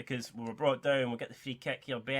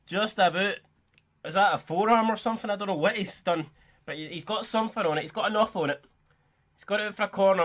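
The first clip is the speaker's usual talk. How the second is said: ordinary talk, nothing marked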